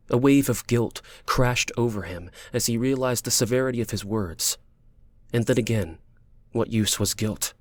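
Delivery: plain reading voice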